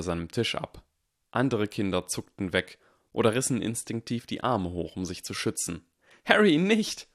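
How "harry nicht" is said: high, loud scream. A narrator's reading. Hermine screams at Harry to stop. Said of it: "schrie Hermine".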